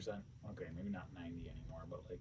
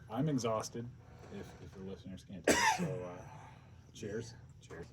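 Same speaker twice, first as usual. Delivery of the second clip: ordinary talk, nothing marked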